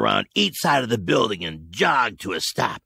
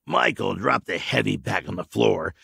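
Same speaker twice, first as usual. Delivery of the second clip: plain reading voice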